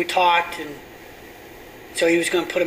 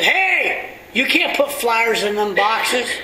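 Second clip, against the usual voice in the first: high, loud mock scold of a stranger; gruff stranger; 6 semitones higher, 4 dB louder, much slower than usual. Pastor imitating a stranger scolding him about flyers, raised mock scold. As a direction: play the annoyed man at the mailboxes, slowed.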